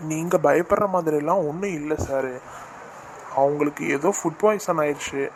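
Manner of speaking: ordinary delivery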